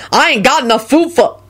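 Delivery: complain, then break off; irritated. A narrator's reading. Helper short of food for guests, irritated.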